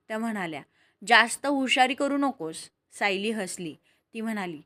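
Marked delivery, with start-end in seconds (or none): 1.1-2.6 mildly cross motherly scolding; a touch of annoyance in a firm warning; mild anger. A narrator's reading. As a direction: scold lightly, without shouting. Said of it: "रागाने पाहू लागल्या".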